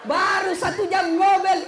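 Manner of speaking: high, loud, laughing punchline. Real, not acted. Preacher landing the jinn joke, high and loud.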